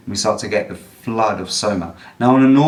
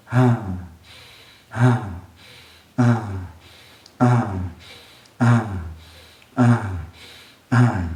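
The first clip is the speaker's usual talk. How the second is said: repeated falling "ah" sighs, evenly spaced; unforced blissful sighs on every out-breath; effortless sighing exhale; 2 semitones lower, 3 dB quieter, slower than usual. Shown as a demonstration. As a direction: let gravity drop each breath out as a toned sigh. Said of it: "With no force, just gravity brings your exhale down with that sound".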